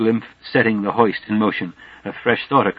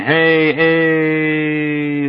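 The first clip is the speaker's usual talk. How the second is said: long drawn-out, higher calls like car horns; dopplering shouts; 4 semitones higher than usual. Acted. Figures shouting as the express passes, dopplering shouts. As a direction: stretch each call like a passing car horn. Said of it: "Shouts go dopplering".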